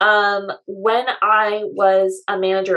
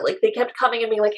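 ordinary talk

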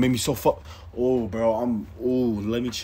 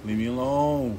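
normal talking voice

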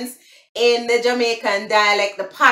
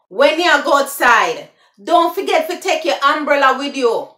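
loud Jamaican patois, higher; 5 semitones higher, 5 dB louder than usual. Shown as a demonstration. Giving viewers the patois version, in Jamaican patois. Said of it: "that's the patois".

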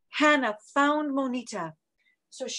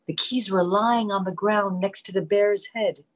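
ordinary delivery, nothing marked

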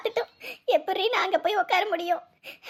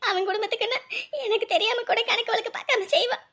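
ordinary talk, nothing marked

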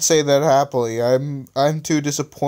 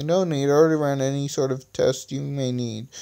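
plain reading voice

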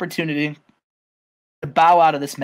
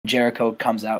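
ordinary talk, nothing marked

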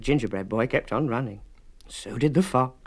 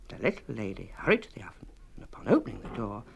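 ordinary delivery

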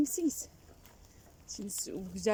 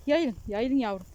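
calling out; 3 semitones higher, 7 dB louder, slower than usual. Real, not acted. Calling out to the sheep.

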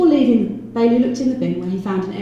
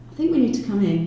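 ordinary delivery

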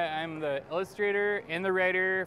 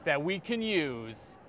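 slow, dramatic trailer announcer; movie trailer narration; much slower than usual. Acted. Author pitches his cat book, movie trailer voice. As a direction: draw it out like an epic film trailer.

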